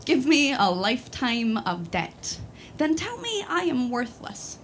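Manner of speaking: usual speaking voice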